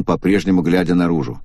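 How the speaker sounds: usual speaking voice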